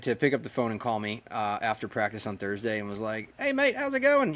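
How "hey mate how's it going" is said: high-pitched New Zealand accent impression. Record holder phoning a driver after practice, imitated New Zealand accent. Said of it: "like, New Zealand accent".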